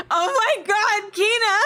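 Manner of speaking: high squeal through laughter; laughing shriek. Real, not acted. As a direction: burst out laughing in disbelief. Laughing shock at blueberry martini.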